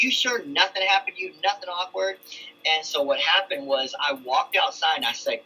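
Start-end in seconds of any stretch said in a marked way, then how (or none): 0.0-2.2 suspicious probing. Retold or imitated. Father probing his daughter.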